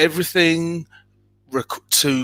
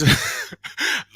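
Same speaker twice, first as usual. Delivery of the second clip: high laugh. Real, not acted. Laughing aside.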